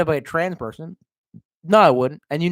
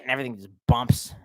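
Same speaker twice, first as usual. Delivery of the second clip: normal talking voice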